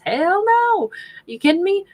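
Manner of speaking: high-pitched, loud, incredulous outburst. Acted. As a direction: scoff at a ridiculous question, pitch shooting up. Scoffs at the idea of loss.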